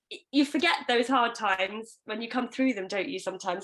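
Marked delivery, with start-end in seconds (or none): none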